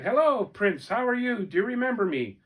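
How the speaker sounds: high, loud false friendliness; sly angry soldier. Acted. Angry soldier greeting the prince with fake cheer. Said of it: "the angry soldier said".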